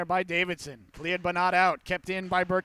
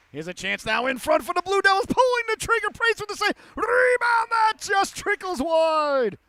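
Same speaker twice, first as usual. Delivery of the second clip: big save call; 9 semitones higher, 5 dB louder than usual. Real, not acted. Announcer to listeners as the goalie stops a chance, shouting. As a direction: build into a roar for the save.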